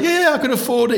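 high, squeaky fake confidence; strained casual lie; nervous bluff. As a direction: cover a lie badly. Lying nervously to the seller.

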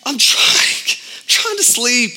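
put-on high, breathy whimper of a kid who can't sleep; half-whispered mock kid whine from bed; whiny kid voice.

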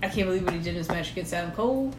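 normal talking voice

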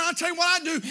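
ordinary talk, nothing marked

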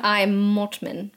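slow, put-on British introduction; British butler accent. Host introducing herself as butler Mortman, mock British accent. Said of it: "say it in that accent".

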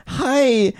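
high-pitched, super annoying customer; annoying girl voice. Retold or imitated. Customer greeting the barista, annoying high voice. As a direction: put on an irritating high girl's voice. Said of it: "super annoying voice".

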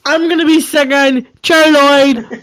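hammed-up shouting; shouted reenactment. Reenacting a video for friends, loud and hammed up.

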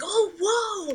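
high-pitched mock amazement; amazed reaction. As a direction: imitate someone suddenly astonished. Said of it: "They're like".

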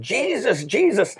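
high-pitched imitation of a frightened cry; mock panicked shouting. Storyteller imitating a passenger in turbulence, mock shouting. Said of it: "this woman starts shouting".

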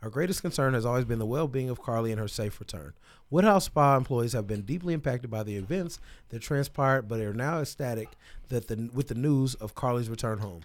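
formal statement read aloud. Real, not acted. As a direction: read it like a press release. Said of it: "reads spa statement aloud".

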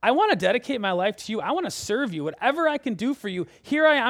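thrilled prophet volunteering; eager and grateful. Pretend. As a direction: build up until you burst with joy. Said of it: "I'm picturing Isaiah being like".

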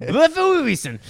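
very high, loud fake Japanese yell; hammed-up Japanese call; mock Japanese accent. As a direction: shriek it in a cartoon Japanese accent. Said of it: "Or in Japanese".

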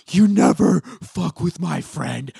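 obnoxious tough talk. Retold or imitated. Cohost mocks Randall defending his new friend, obnoxiously loud. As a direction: imitate an over-the-top protective friend. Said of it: "so obnoxious when Randall".

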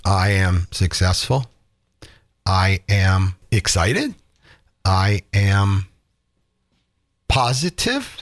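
flat, slow, low "I am" lines with no conviction; boring delivery. Shown as a demonstration. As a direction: say it like you doubt every word. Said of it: "I'm boring".

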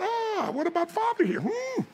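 very high, exaggerated whine of protest; mock wounded plea. Father left out of the credit by daughter, mock wounded. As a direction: ham up being the overlooked dad. Mock hurt at being overlooked.

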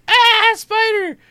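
high, slow, loud frightened cry; hammed-up little-girl scream; scared shriek. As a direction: shriek at the spider like a scared kid. Older daughter frightened by spider.

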